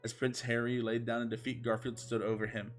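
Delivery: ordinary talk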